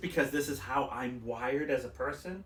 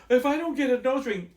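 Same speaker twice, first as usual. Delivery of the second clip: higher-pitched mock fretting; mocking worry; 7 semitones higher, 4 dB louder than usual. Mocking people who all want a nose ring, fake fretting. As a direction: mimic someone needlessly worried, pitch raised.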